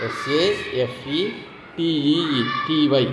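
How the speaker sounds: each letter stretched out slowly; very slow spelling. Shown as a demonstration. Teacher spelling safety very slowly for students. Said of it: "teacher spells word very slowly".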